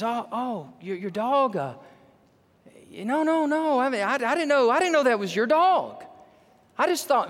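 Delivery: high, stumbling, caught-out excuses; flustered stammer. Retold or imitated. Young dog thief facing the owner and sheriff, flustered stammer.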